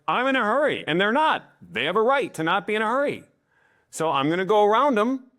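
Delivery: high, insistent reasoning; reasonable choleric driver. Acted. Choleric driver allowing others not to hurry, insistently reasonable.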